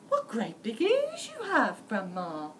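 put-on a small girl wondering aloud; little girl voice. A narrator's reading. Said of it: "and then she said".

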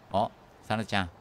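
ordinary talk

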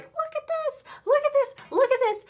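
very high, giddy repeats; high thrilled show-off; excited squeal. Retold or imitated. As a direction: squeal it at your friends. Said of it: "I was like".

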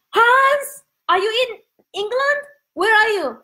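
high, delighted calling out; excited greeting. Real, not acted. Host spotting friend Hans in her live, excited high call.

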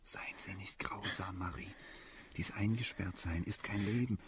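hushed, urgent whisper; pleading whisper. Acted. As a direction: beg her softly. Flehendes Flüstern zu Marie. (flehend flüsternd)

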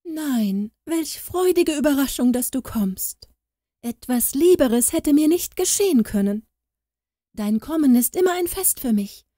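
high, sugary, overdone delight; fake gushing welcome. A narrator's reading. Hypocritical wife greeting the rich aunt, high fake gushing. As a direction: lay the false joy on thick and high. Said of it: "lief die Hausfrau der alten Tante mit ausgebreiteten Armen entgegen und rief".

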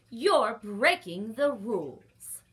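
loud, slow reprimand; shocked loud reprimand; loud scolding. Acted. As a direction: scold the lion for the rule. Librarian scolding the roaring lion.

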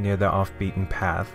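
usual speaking voice

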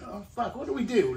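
ordinary talk

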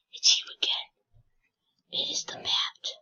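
unvoiced eerie whisper; spooky whisper. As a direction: hush it like a creepy reveal. Spooky whisper about enemy.

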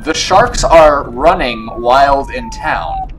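slow, loud word-by-word reading; drawn-out, incredulous announcement; slow, emphatic repeat. Acted. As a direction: spell it out slowly and loudly. Repeats line slowly after "Wait, what?.

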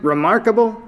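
mock Gerard's own word Remarkable? with a high sarcastic rise; sarcastic high echo of Remarkable?. A narrator's reading. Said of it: "What was it you called him again?".

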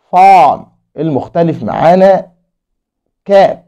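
normal talking voice

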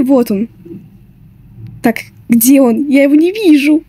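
ordinary talk, nothing marked

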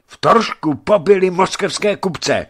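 high, loud, alarmed report; urgent gasped news. A narrator's reading. Messenger breaking news of killings to merchant, alarmed. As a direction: blurt out the terrible news. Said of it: "vydechl posel".

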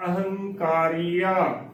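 ਅਹੰਕਾਰੀਆ drawn out syllable by syllable; slow correct pronunciation. Shown as a demonstration. Teacher models the word slowly for learners. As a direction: sound out a-hang-kaa-ria slowly. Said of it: "ਇਹ ਬਿਲਕੁਲ ਸਹੀ ਉਚਾਰਨ ਹੈ".

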